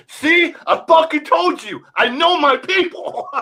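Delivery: high, loud triumphant comedian; Akash Singh. Retold or imitated. Comedian crowing to the crowd after guessing right, triumphant. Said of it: "And the Akash is like".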